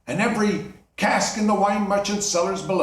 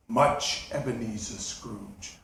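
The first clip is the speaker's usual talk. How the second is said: low, much softer ghostly answer; solemn ghost; 7 semitones lower, 5 dB quieter, slower than usual. Acted. Marley's ghost answering Scrooge, low and hushed. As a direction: answer from the grave, hushed and heavy.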